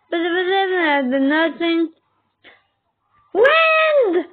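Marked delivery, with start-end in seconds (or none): 3.3-4.3 excited shout. Real, not acted. Shouting along with the video.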